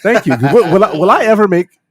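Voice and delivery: high, loud eager question; excited. Real, not acted. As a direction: blurt out hopeful thanks. Excited hopeful outburst.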